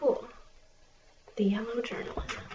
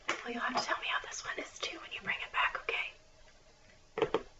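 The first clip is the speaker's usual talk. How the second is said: library whisper; 7 dB quieter than usual. Acted.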